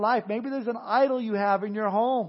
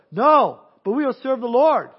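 louder, insistent protest; 4 semitones higher, 9 dB louder than usual. Retold or imitated. The people protesting to Joshua, loud and insistent. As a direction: shout back in defiance.

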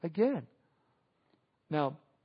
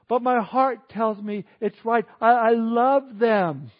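slow, louder pleading, stretched out; pleading lover; 3 semitones higher, 7 dB louder, slower than usual. Acted. Believer insisting to the pastor, slow drawn-out mock plea. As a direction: beg to be understood, dragging the words.

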